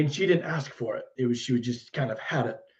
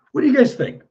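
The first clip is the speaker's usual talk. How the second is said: pitched up high and louder, eager for answers; excited question; 12 semitones higher, 8 dB louder, faster than usual. Real, not acted. Painter asking stream viewers for opinions, excitedly pitched up. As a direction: bounce the question up to chat.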